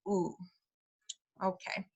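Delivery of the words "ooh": soft "Ooh" with tightly rounded lips; a pure Spanish "Ooh", low and quiet. Shown as a demonstration. Teacher contrasting Spanish U with English, very rounded. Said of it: "your lips should be very rounded".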